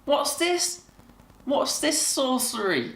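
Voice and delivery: high, wide-eyed mock amazement; recalling a kid's delight at two Van Dammes, high and amazed. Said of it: "I just thought".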